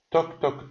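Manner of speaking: high, clipped toc toc knocking; imitated knocking. Shown as a demonstration. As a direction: sound out a sharp knock high up. (صدای در زدن بلند و زیر)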